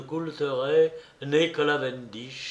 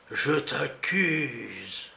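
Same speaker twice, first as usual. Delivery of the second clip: voix d'outre-tombe; 4 dB quieter, much slower than usual. A narrator's reading. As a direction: intone it slowly from beyond the grave. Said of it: "d'une voix d'outre-tombe". (voix d'outre-tombe lente et caverneuse)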